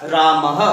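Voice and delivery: "रामः" with a light breathy ह at the end; visarga demo. Shown as a demonstration. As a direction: end "रामः" on a half-length ह. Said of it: "तो ये पूरा क्या हो जाएगा". ("रामः" में आधा ह)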